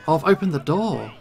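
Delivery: ordinary delivery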